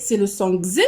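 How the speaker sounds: voiced "gz" sound for the letter X. Shown as a demonstration. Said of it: "selon la prononciation".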